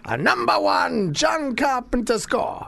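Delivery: grand hammed-up maestro; mock Italian accent. Morricone promising John a tribute score, Italian accent. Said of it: "I said".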